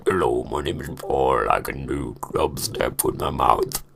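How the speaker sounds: very low, slow mock introduction; dubstep voice. Vlogger playing an American Idol applicant, absurdly deep voice. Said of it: "make my voice go like that".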